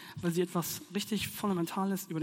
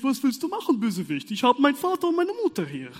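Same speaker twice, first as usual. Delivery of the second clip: loud, higher taunting of a villain; defiant child; 5 semitones higher, 9 dB louder than usual. Retold or imitated. Child in bed taunting the villain, loud and cocky. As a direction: tell off the bad guy, safe behind your parents. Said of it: "denkt sich das Kind".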